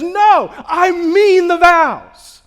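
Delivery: loud, high, slow insistence; earnest shout. Retold or imitated. Couples at the altar shout they mean their vows. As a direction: cry out the promise.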